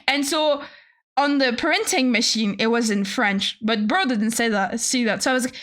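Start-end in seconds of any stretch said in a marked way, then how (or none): none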